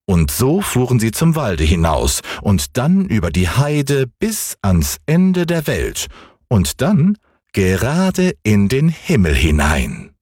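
ordinary delivery, nothing marked

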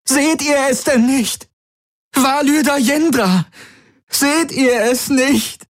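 high-pitched frantic protest; desperate screaming. A narrator's reading. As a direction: scream as if fighting off your captors. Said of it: "schrie Kirai". (verzweifeltes, hohes Schreien)

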